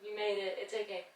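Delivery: calm them after the effort; quiet tender comfort; soft reassurance. Real, not acted. Soothing after hard hold.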